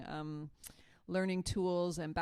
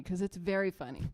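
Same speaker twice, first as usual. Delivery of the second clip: ordinary talk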